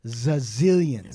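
slow stretched zazillions; drawn-out zazillions. Real, not acted. Describing the rich lawyer's wealth, slow stretched word.

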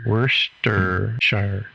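Worcestershire drawn out very slowly, syllable by syllable; slow careful pronunciation. Shown as a demonstration. Repeating the English fan's pronunciation slowly. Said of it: "English fans said Worcestershire".

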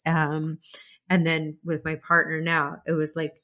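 ordinary delivery

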